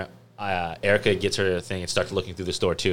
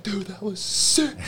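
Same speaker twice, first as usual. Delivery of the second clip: high, slow hyped bro voice; frat bro; slower than usual. Retold or imitated. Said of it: "I was like".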